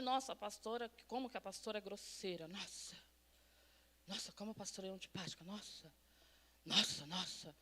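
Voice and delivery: low, hushed imitation of shocked complainers; quiet mock disapproval, trailing to near nothing; mock grumbling churchgoers. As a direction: grumble it under the voice, scandalized. Mimics congregants grumbling about her.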